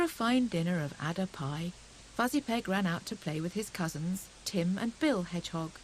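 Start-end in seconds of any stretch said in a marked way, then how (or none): none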